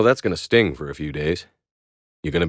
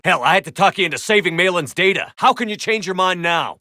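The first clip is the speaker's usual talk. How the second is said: raised, high-pitched frustration; angry; 10 semitones higher than usual. Acted. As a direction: argue hotly. Heated argument with squadmate.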